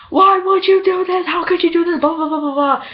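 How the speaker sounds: high-pitched, whiny accusing rant; mock outraged nagging. Angry caller demands why she did it, mock outrage. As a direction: imitate an upset caller, easing into yammered blahs.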